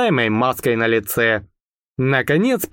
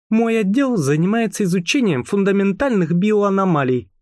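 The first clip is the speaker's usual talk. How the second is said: cold, higher, cutting; icy tone; 6 semitones higher than usual. Acted. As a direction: freeze them out with every word. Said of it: "ледяным тоном произнесла она". (ледяным тоном)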